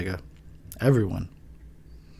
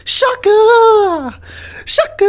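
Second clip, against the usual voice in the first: very high, loud mock announcer yell; hyped shout; 9 dB louder, much slower than usual. Announcing his joke movie title with a loud, very high yell. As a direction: belt the title out like a trailer.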